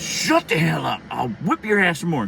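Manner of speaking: retold mother's forceful outburst; angry mom threat. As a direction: cut the child off with a threat.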